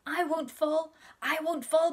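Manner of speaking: put-on a stubborn little girl promising; little girl insisting. A narrator's reading. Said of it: "My naughty little sister said".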